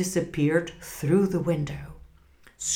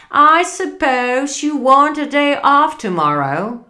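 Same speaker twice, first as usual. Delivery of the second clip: gruff miser; 7 semitones higher, 9 dB louder than usual. Acted. Scrooge gruffly addresses clerk.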